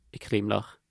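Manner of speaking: usual speaking voice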